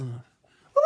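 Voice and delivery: a short loud whoop; short loud party whoop. Pretend. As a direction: whoop like at a concert.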